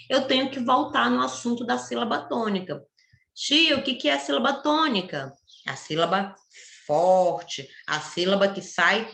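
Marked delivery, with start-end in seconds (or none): 3.3-5.3 lightly voiced pupil, higher pitched; a hint of a curious pupil asking; pupil's question. Pupil asking the teacher about the stressed syllable, lightly voiced question.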